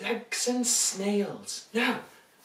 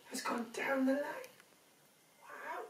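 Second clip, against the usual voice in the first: hushed storybook whisper; 6 semitones higher, 9 dB quieter than usual. Acted. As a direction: whisper it like a secret. Whispered aside.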